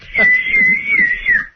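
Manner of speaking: bursting out laughing; a big, high whooping laugh. Real, not acted. Host cracks up at joke.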